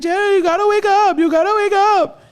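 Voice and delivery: very high, loud, slow nurse voice calling him awake; imitating the nurse. Nurse shaking the patient awake, very high loud slow mock call. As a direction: drag him out of sleep like the nurse.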